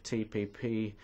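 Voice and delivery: ordinary delivery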